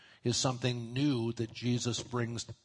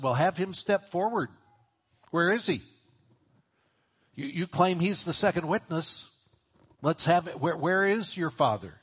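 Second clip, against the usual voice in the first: louder, higher sneering challenge; mocking sarcasm; 5 semitones higher, 4 dB louder than usual. Pharisees mock Jesus, demanding his Father step forward: loud sarcastic taunt. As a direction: taunt him to produce the witness. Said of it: "a hint of sarcasm".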